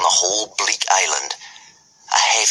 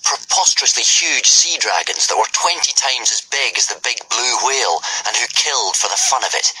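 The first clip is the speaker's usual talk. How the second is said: ordinary delivery, nothing marked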